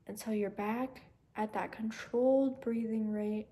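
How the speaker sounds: soft, fading calming guide voice; guided meditation. Acted. Guides breathing to calm.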